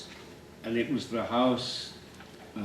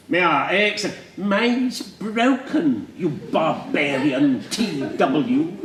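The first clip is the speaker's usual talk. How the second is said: high, loud Queen's English scolding; mock the poem as an outraged posh teacher; nicely spoken teacher; 6 semitones higher, 5 dB louder, slower than usual. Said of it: "He was nicely spoken".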